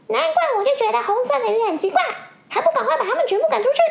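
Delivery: high, loud and fast, scolding; angry blue fish. Acted. Blue fish demanding the red fish be expelled, angry fast. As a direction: spit out the order to drive them away. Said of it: "蓝色的鱼开始生气了".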